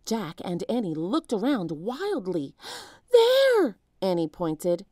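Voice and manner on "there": much higher, louder shout; urgent shout. A narrator's reading. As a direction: point and shout the warning. Spotting the ants.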